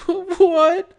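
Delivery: high, terrified squeak; almost crying. Acted. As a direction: squeak it out in terror. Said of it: "Butterbread almost cried".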